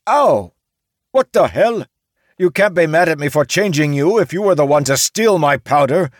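very high, loud shriek. A narrator's reading. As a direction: samurai shrieking back at the orc. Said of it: "the samurai shrieked".

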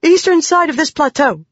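high, urgent, panting report. A narrator's reading. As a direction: report urgently while catching your breath.

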